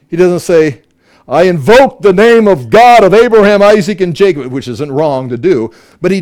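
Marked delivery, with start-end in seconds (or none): 1.3-4.5 loud, raised, ceremonial proclamation; booming mock incantation; mock solemn invocation. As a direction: intone it like a grand ritual formula. Mock ritual invocation of God.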